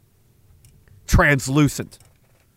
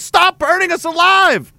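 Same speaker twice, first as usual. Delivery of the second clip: pleading villagers; 10 semitones higher than usual. Pretend. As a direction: cry up at the sun to spare them. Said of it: "They're like".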